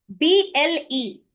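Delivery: letters spelled slowly one by one; spelling out letters. Shown as a demonstration. Teacher to trainees, slowly spelling out letters. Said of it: "this is magic E".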